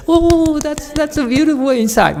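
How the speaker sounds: very high, gushing falsetto; hammed-up swooning admiration; mock admiring audience.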